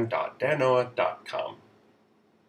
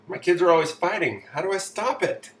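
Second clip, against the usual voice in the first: lightly acted parent, raised pitch; quick, higher read with a hint of complaint; re-read parent question; 7 semitones higher, 4 dB louder than usual. Re-reading the same question after aside.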